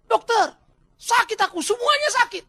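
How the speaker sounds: loud, high wail of complaint; hammed-up pained shout; yelling in pain. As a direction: play a patient crying out to the doctor. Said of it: "dia teriak-teriak sakit".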